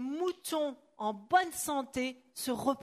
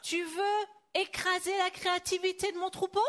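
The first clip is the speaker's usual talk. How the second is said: higher, indignant challenge; acted threat; 7 semitones higher than usual. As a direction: confront the attacker in a raised pitch. Interpreter re-enacts the shepherd's threat.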